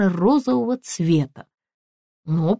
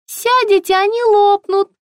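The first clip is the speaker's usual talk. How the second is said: very high, chirpy shop assistant; high-pitched, ringing sales voice; ringing saleswoman voice; 14 semitones higher, 5 dB louder than usual. A narrator's reading. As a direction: pipe up brightly through the door.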